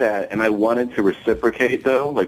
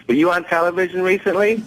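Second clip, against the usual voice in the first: high-pitched boxer voice; Mike Tyson; 10 semitones higher, faster than usual. Retold or imitated. Boxer quizzing comedian on the plane, high-pitched imitation. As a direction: ask it high, pitch held up. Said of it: "What's up, Mike?".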